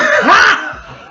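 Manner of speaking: a high whooping burst of laughter; loud laughing shout. Real, not acted. Streamer howls with laughter at the game's scene.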